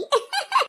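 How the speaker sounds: high-pitched burst of laughter; teasing laugh. Acted. Neighbour mocking the lisping bride, high teasing laugh.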